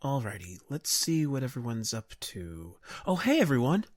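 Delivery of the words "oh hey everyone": higher, louder cheerful hello; excited greeting. Acted. Captain greeting returning crewmates, excited call. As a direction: call out happily to the crew.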